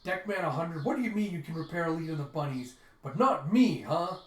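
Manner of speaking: loud, high, indignant yelling; angry protest. Retold or imitated. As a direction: shout it out like you've been cheated. Zex protests angrily at Jeff.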